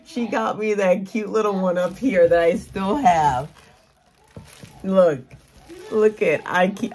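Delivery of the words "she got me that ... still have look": usual speaking voice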